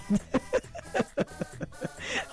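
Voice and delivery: long, very high breathy laughing fit; real laughter. Real, not acted. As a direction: crack up completely. Cracks up at Michael's message.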